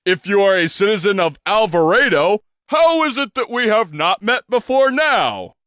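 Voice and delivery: a pompous stage gentleman's introduction; grand old showman. Acted. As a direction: present yourself like a theatrical impresario. Bostwick asks the head.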